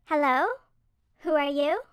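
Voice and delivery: mildly taken aback; slightly surprised. A narrator's reading. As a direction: answer an unexpected visitor with mild surprise. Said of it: "slightly surprised".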